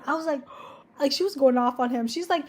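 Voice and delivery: ordinary talk, nothing marked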